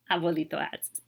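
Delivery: ordinary delivery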